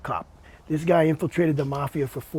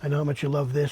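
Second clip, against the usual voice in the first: usual speaking voice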